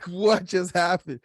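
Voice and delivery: stunned disbelief. Retold or imitated. Replaying his stunned reaction.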